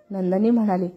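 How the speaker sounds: ordinary delivery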